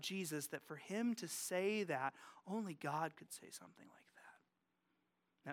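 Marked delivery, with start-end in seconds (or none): 2.5-5.5 unvoiced hushed whisper. Real, not acted. Pastor hushes as scribes reach their conclusion, whispered. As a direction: breathe it like a secret.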